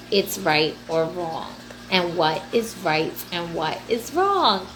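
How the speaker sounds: ordinary talk, nothing marked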